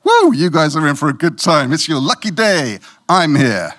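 loud, hammed-up bragging; smug boast. Preacher mocks boasting to the congregation, hammed-up. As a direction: crow about being here, loudly. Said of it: "imagine if I'd come in this morning".

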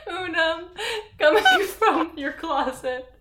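high, laughing through words; giggling through the sentence; laughing while talking. Real, not acted.

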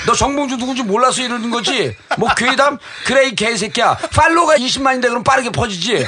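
high-pitched retold rant; blustering indignation, played for laughs; angry boasting. Politician pulling rank on the reporter by phone, angry bluster.

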